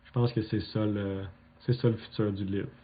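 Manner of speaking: usual speaking voice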